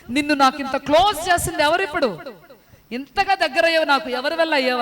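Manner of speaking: fervent preaching. Acted. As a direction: press the point home to the congregation. Preaches to the congregation. (ఉత్సాహంగా బోధిస్తూ, గట్టిగా ప్రశ్నించడం)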